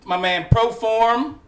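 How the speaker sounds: loud, high, drawn-out announcer call; hype guest introduction. Acted. Host presents Proform, hyped announcer style. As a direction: announce the guest slowly and big.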